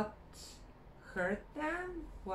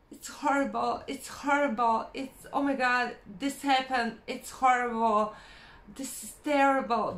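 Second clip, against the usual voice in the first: louder, exaggerated moaning, hitting horrible and terrible; loud dramatic complaining; 4 semitones higher, 7 dB louder than usual. Mimicking people who complain about everything, loud mock moaning. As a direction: ham up the endless complaint with mock despair. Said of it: "There's an expression in Croatia".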